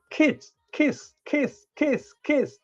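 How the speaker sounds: high, soft kiss kiss kiss; teasing baby-talk kisses; mocking kissy sounds. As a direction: coo the kisses like a doting dog lover. Mimicking people kissing dogs.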